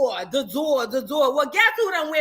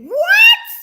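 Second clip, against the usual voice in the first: high shocked shriek of disbelief; shocked what; 12 dB louder than usual. Retold or imitated. Gossip host hearing shocking news, loud shocked shriek.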